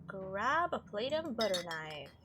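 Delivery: ordinary talk, nothing marked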